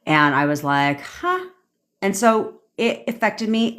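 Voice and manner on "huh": stunned huh. Retold or imitated. Shocked at team walking out.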